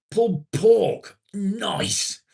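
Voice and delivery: high, loud, slow gleeful pulled pork; very high excited squeal. Real, not acted. Streamer delighted by a food mention, very high squeal.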